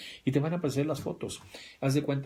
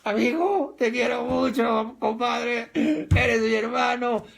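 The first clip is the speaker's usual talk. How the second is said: much higher, louder, slow slurring gush; drunk buddy; 12 semitones higher, 7 dB louder, slower than usual. Retold or imitated. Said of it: "Pero ya borracho". (borracho efusivo, lento, muy fuerte y agudo)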